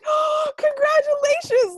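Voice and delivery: put-on very high, loud baby praise; gushing praise. Parent praising a baby's first try, very high and gushing. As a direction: cheer a baby's first step. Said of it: "when they do something for the first time".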